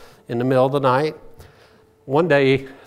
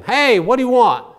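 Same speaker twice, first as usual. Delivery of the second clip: mock yell; 7 semitones higher, 3 dB louder than usual. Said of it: "the man starts yelling at him".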